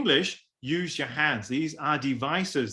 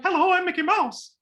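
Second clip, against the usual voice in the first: much higher cartoon mouse voice; Mickey Mouse falsetto. Shown as a demonstration. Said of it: "it's falsetto sound like".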